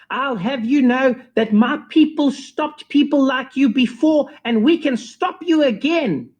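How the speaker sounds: high, haughty woman voice; teacher warning the DJ, mock haughty. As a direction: threaten them as the prim teacher. Teacher threatening.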